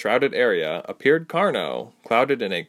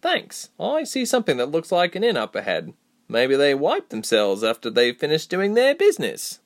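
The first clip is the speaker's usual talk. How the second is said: higher-pitched companion, joking; the friend's higher voice, cheeky; wry Reshma; 6 semitones higher than usual. Acted. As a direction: quip in a raised character voice.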